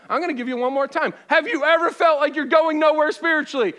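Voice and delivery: loud, high, rising preacher call; preaching at full force. Acted. Pastor pushes the congregation to raise their hands, shouting. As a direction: drive the room to raise hands.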